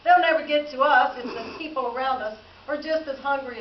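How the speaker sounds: loud, high scoffing "Ha!" into the remark; scoffing chuckle. A narrator's reading. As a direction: chuckle it out loudly to a husband. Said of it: "I chuckled to my husband Abel".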